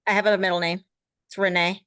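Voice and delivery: ordinary delivery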